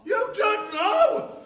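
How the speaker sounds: loud, high, drawn-out disbelief; appalled outburst. Acted. Father to pregnant daughter who can't name father, appalled shout. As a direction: shout it back incredulously.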